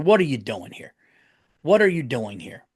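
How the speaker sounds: ordinary delivery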